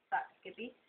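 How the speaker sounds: ordinary delivery, nothing marked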